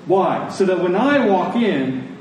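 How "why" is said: normal talking voice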